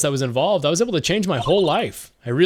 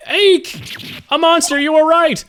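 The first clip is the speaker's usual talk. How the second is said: squeaky high shriek, louder; mock fright; 11 semitones higher, 5 dB louder, slower than usual. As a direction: squeal like a startled little girl. Voicing scared girl character.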